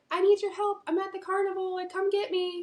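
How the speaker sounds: high-pitched imitation of a boy pleading; put-on high voice calling for help; Jonathan. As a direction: plead up high like a kid in trouble. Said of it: "she possessed Jonathan's voice".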